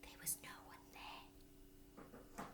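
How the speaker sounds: very soft, slow unvoiced narration; hushed whisper. A narrator's reading. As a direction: breathe it in suspense.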